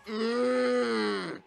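long, loud, high groan of frustration; drawn-out exasperated "mmm"; frustrated groan. Real, not acted. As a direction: groan it out through closed lips. Frustrated reaction after cursing in game.

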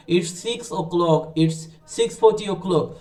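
slow, drawn-out wrong version; wrong way demo. Shown as a demonstration. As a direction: show the mistake slowly. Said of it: "আমরা এভাবে করে বলবো না".

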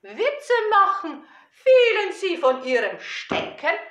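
playful, slow, drawn-out verse with big swings; children's poem recitation. Acted. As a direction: recite it theatrically for small children.

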